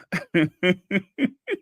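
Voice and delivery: rising run of giggles; helpless chuckle climbing in pitch; laughing. Real, not acted. Host amused by a viewer's pancake joke, giggling.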